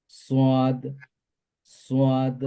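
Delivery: "সাদ" as a full, rounded "swaad"; rounded-mouth S. Shown as a demonstration. Teacher contrasts it with sin, "সাদ" with rounded mouth. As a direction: round the lips on "সাদ", even and drawn out. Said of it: "মুখটা গোলাকার হবে".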